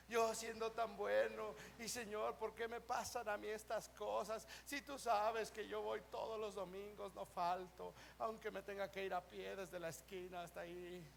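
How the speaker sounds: low, soft mock pleading; mock self-pitying prayer. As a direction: whimper a hammed-up poor-me prayer. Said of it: "cómo oramos muchas veces".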